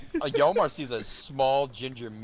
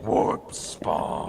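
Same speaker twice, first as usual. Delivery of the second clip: heavy breathing snarl; 5 semitones lower than usual. Acted. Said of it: "foaming at the mouth and breathing heavy".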